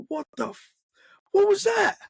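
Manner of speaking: high, startled "what was that"; stunned, disbelieving question; shocked. Retold or imitated. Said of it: "we both looked at each other like".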